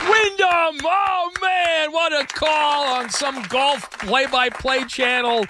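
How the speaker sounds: high, thrilled exclamation; excited reaction. Acted. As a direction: marvel at it in a high, carrying voice. Reacts to excited golf call.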